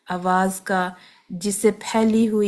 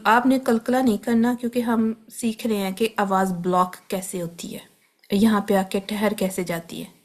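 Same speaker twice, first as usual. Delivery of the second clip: ordinary delivery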